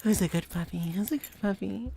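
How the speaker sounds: gentler doting pet voice; Lilac impression. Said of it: "That was my lilac impression".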